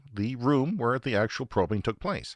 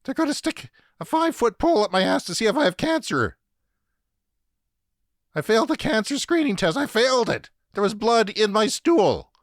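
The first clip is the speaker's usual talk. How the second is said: high, strained, frantic outburst; replaying a panicky snap in a much higher voice; snapping; 12 semitones higher, 5 dB louder than usual. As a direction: squeal it out in panic at the nurse. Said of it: "And I snapped".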